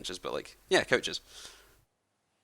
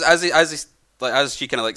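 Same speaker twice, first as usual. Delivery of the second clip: ordinary delivery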